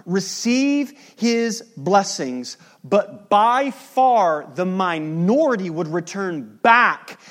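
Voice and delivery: preaching at full force. Acted. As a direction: build it up to the congregation. Preaching climax.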